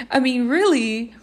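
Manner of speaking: ordinary talk, nothing marked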